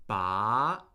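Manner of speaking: Ba rising from a low start; second tone on Ba. Shown as a demonstration. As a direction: let "Ba" climb steadily upward at the end. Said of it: "is a rising tone".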